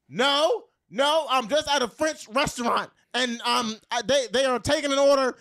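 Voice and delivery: high, loud, panting cover story; out of breath lying. Retold or imitated. Lying to her man while running, breathless and loud. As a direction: gasp out a quick lie while running. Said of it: "and I'm out of breath".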